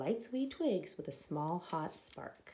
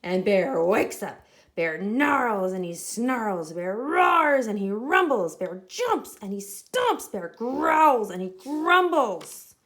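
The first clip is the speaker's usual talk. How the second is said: much louder dramatic telling; rising excited narration; 10 dB louder than usual. A narrator's reading. Narrator tells the angry bear waking, loud dramatic. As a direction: build up the bear's rage.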